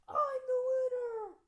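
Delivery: very high, very loud victory yell; triumphant shout. Acted. Merman racing his wheelchair past, shouting in victory. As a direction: scream it as you zoom past first. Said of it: "the merman shouted".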